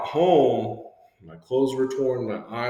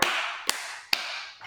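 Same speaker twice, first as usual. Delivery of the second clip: mock shocked gasp; 6 dB quieter than usual. Shock at being spanked.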